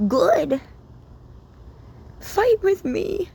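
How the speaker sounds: fervent retold reply. Voicing God's encouraging reply.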